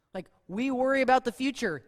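normal talking voice

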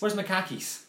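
a quick Boston accent on the line. Shown as a demonstration. Boston accent for the friends on the podcast. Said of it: "in a Boston accent".

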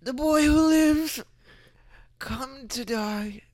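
slow, menacing dark wizard; Voldemort voice. Acted. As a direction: drawl it out like a villain. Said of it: "You look like Voldemort".

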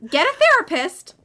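high, loud and indignant; exasperated scolding. Acted. Scolding the male characters.

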